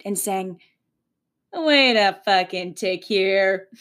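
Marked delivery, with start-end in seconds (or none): 1.5-3.7 slow, deliberate double-take; slow, wry aha moment; sudden realisation. Pretend. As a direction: stop yourself short in disbelief. Quoting her own realisation.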